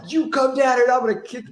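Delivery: high, loud Southern prank-caller threat; mock threatening yell. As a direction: holler it like a hammed-up hothead. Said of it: "he'll threaten them and get 'em".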